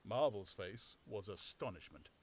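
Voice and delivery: ordinary delivery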